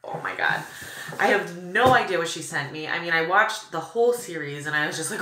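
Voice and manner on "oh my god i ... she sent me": normal talking voice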